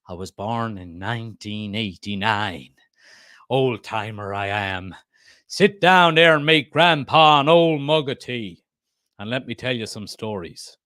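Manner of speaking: put-on slow old-timer Southern drawl; Southern American accent. Old-timer telling youngsters his birth year, Southern American accent. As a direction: play a Southern grandpa, slow and mostly low. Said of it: "you have a Southern American accent".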